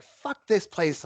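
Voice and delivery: high, drawn-out retold cursing; mock a drunk friend cursing on the way out; slow, high-pitched acted outburst; imitated angry parting shot. Retold furious parting shot on leaving.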